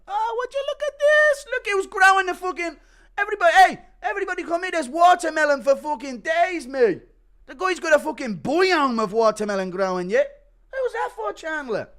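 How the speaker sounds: high, loud gleeful shouting; [name] gleeful. Acted. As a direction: yell to everyone over the find. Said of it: "[name]'s breaking".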